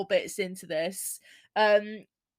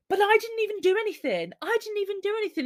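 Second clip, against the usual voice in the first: high, fast whiny protest; whiny teen protest; 12 semitones higher, much faster than usual. Retold or imitated. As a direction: whine like a teen caught out and denying it. Imitating Charlie's protest.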